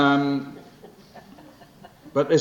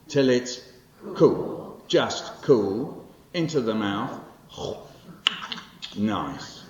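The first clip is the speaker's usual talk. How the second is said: lively poem performance; 3 dB louder, much slower than usual. Acted. Poet performing his childhood poem to the audience, lively and animated.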